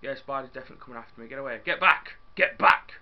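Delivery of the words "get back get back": yelling at the spider to get back; loud panicked shout. Real, not acted. Gamer yelling at an attacking spider, loud shout.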